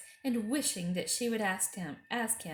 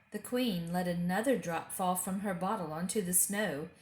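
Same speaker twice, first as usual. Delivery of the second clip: ordinary delivery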